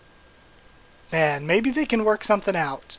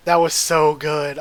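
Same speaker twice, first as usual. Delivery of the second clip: louder, slowed gush on "that"; excited gush; 9 dB louder, slower than usual. Real, not acted. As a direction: savour every word, thrilled. Gushing after reading a chapter.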